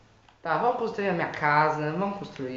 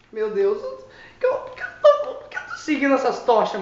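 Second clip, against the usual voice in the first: nervous laughing; 6 semitones higher, 10 dB louder than usual. Real, not acted. Spooked by strange torches.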